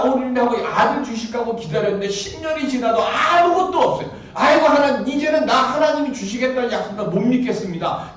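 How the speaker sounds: loud, high, half-laughing lament; loud mock-despairing plea; exasperated complaint. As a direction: wail Abraham's frustration at God. Abraham's complaint voiced by preacher.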